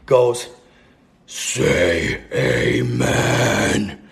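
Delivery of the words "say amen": very slow, low demonic growl; drawn-out deep demon voice; growly demon. Retold or imitated. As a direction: growl it out, dragging every word. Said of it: "it's in that growly voice".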